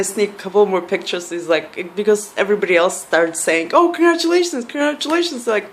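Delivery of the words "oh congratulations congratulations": high-pitched mock congratulations; imitated well-wishers. Imitating crowd congratulating father-to-be.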